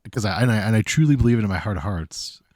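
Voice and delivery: ordinary talk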